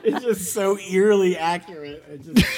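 squealing high laugh through the words; can barely get the words out for laughing. Real, not acted. Cracking up at the impression.

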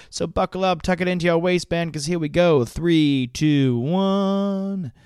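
high, excited countdown hype; grand entrance announcement. Acted. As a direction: count it down like a show opener. Countdown into the show.

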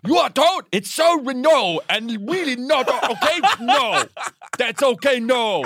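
a dad losing his English in anger; flustered dad impression. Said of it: "he'd be like".

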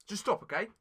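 normal talking voice

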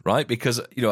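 ordinary talk

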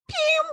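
high zapping pew; mock blaster noise. Retelling the tar monster killing her, high blaster pew. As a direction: make the ray-gun sound.

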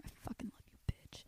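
breathy unvoiced whisper; real soft whispered love. Real, not acted. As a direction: whisper it fondly aside. Whispered aside to listener.

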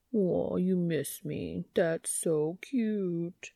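put-on slow cooing baby talk; sing-song baby voice. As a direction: tease her like cooing at a baby. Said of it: "Dean sing-songs in a baby voice".

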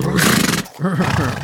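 imitated low, rumbling horse snort. Big horse answering the narrator, mimicked snorting. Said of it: "snorty noises".

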